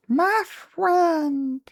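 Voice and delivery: very high alien rasp; squeaky little alien; Stitch voice. Acted. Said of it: "I need to fix my Stitch".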